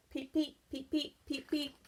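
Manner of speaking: high soft peeping; frog peeps. Acted. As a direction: chirp like a tiny spring frog. Frog sound effect in story.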